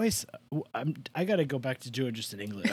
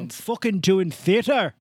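loud, higher put-on Irish brogue; Irish accent; 6 semitones higher, 6 dB louder than usual. Irish actor explaining why he is busy, Irish accent. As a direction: burst out in a hammed-up Irish accent.

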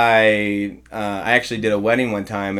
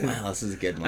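quiet; 3 semitones lower, 11 dB quieter, faster than usual. Retold or imitated. Pastor's inner remark.

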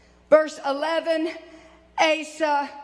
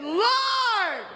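crying out; 5 semitones higher than usual. Retold or imitated. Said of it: "Asa cried to the Lord and said".

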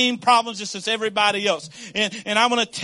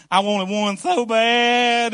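slow, drawn-out and louder; longing craving; 3 semitones higher, 4 dB louder, much slower than usual. Acted. Pastor on a diet longing for his wife's pancakes, slow and drawn out. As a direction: stretch every word with longing.

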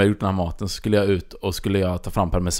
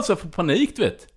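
ordinary talk, nothing marked

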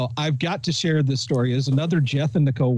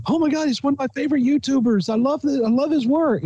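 mock excited friend; gushing fan; 10 semitones higher than usual. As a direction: imitate a fan gushing over a YouTuber. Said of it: "she goes on to tell me".

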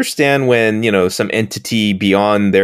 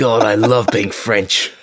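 put-on French accent; slower than usual. Parodying Picard as French.

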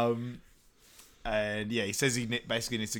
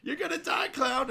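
mock angry yell. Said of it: "but yeah".

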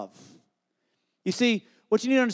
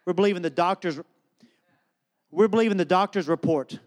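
ordinary talk

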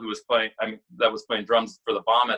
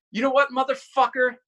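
a mock angry outburst, pitched high; half-acted rage at a rude idol; retold angry outburst; 12 semitones higher than usual. Young guitarist confronting his hero, mock angry outburst. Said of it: "and I was like".

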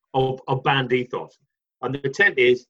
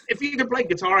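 usual speaking voice

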